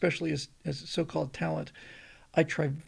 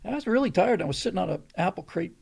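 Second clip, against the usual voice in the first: ordinary delivery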